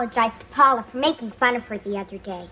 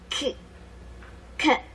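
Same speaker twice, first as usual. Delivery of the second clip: stuttering on the k sound; 9 dB quieter than usual. Acted. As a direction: get stuck on the first sound. Stuttering girl reads word list.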